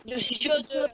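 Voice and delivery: put-on deep voice. Boy giving his age, put-on deep voice. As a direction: fake grown-up voice. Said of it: "hacés la voz gruesa".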